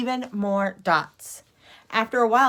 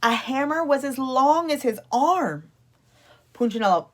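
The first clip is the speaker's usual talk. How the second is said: usual speaking voice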